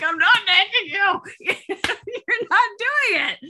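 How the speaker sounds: high, laughing exasperated protest; exasperated laughing retort. Retold or imitated. Mother laughing back at her son's nagging accusation, high-pitched.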